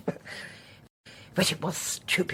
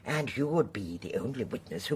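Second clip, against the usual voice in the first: softer, whispered endearment; menacing whisper; 9 semitones lower, 6 dB quieter than usual. Acted. Poisoner taunting the trapped girl, whispered. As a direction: hiss it like a threat.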